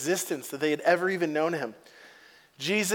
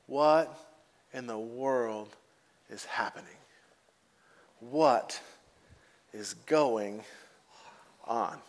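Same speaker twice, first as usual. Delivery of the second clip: hushed wondering; 7 semitones lower, 7 dB quieter, slower than usual. Acted. Disciples wondering aloud, hushed.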